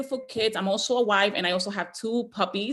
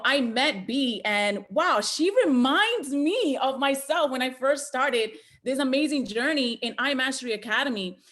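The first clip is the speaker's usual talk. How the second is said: ordinary talk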